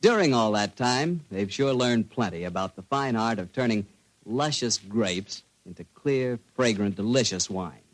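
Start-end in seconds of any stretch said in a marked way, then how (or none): none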